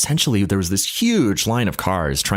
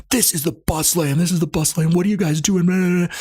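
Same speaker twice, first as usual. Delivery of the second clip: high-pitched mock angry cop; cop chewing them out; 11 semitones higher than usual. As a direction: bark at the drivers like a fed-up cop. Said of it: "this cop just absolutely reams".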